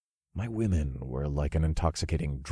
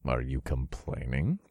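teasing; 4 semitones lower, 5 dB quieter, slower than usual. A narrator's reading. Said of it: "I teased with a smirk".

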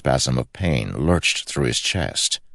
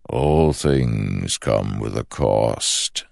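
slow ancient shadow voice; 3 semitones lower, much slower than usual. Acted. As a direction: speak like a patient shadow god. The shadow speaks.

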